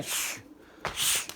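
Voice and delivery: soft shushing to calm the birds; a quiet hushing hiss. Real, not acted. Shushing the pigeons. (kuşları susturan yumuşak bir şşş)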